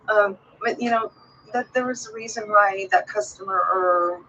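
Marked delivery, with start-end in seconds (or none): none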